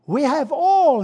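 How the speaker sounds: loud, high burst of joy; quoted jubilant outcry; joyful exclamation. Retold or imitated. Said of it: "he exclaimed in his joy".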